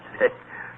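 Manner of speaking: short breathy mocking chuckle; a scornful little laugh; dry chuckle. Scrooge mocks talk of the poor.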